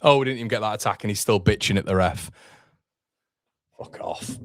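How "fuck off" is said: whispered curse. Real, not acted. Annoyed at rival manager complaining.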